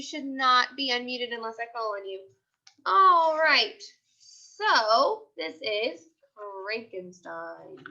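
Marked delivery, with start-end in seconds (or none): 4.2-7.8 put-on lively storybook voice for young kids; picture book read-aloud. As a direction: read it like a class story time. Teacher reads picture book aloud.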